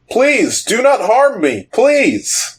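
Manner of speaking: very high, wailing plea; pleading king. Acted. Evil king begs the adventurers for mercy, pleading. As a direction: beg in a high, cowering voice. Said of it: "evil king, and he goes".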